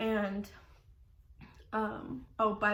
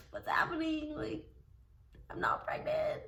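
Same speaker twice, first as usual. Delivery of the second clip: disbelief; 7 semitones higher than usual. Retold or imitated. Stunned at the ultrasound.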